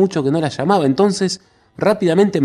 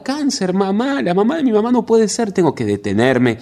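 higher pitched, distraught disbelief; desperate cry; 7 semitones higher than usual. A narrator's reading. Margarita refusing the terrible news, high distraught cry. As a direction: plead it in rising panic. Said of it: "gritó Margarita desesperada".

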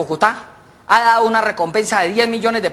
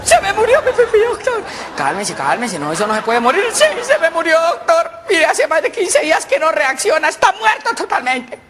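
whiny wail; 12 semitones higher than usual. Acted. Patient wailing to the doctor in a very high voice.

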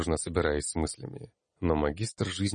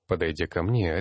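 plain reading voice

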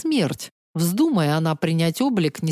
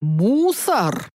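puzzled dragon. A narrator's reading. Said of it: "искреннее недоумение".

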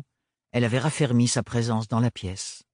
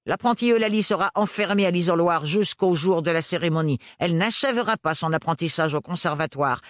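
stony resonant voice; 8 semitones higher than usual. A narrator's reading. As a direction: speak like living marble. Hélène sentences. (voix minérale et résonnante)

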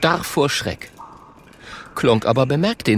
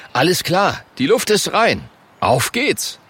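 breezy, higher all-clear call; confident caveman; 7 semitones higher than usual. Acted. Caveman Klonk waves the friends into the cave, confident.

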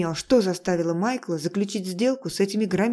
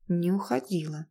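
usual speaking voice

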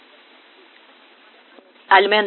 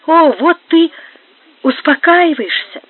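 relieved; 7 semitones higher than usual. Acted. Альмендра relieved that Горацио calms down, eager. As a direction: lift up with relief. Relieved, consoling her lover.

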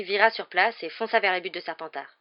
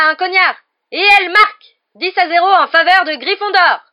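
high, loud play-by-play building to a goal shout; excited sports commentator; 10 semitones higher, 15 dB louder than usual. A narrator's reading. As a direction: cheer your team on while commentating. Commentates the match as Lee Jordan. (commentateur partisan survolté)

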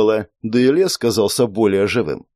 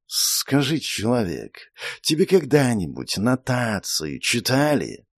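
serpent spirit voice; 5 dB quieter than usual. Acted.

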